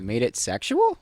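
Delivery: "sexual" swung up at the end; parroting back, with a questioning rise on "sexual"; Cameron. Pretend. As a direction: echo "You made it sexual?" as a question. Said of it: "puts a question mark behind it".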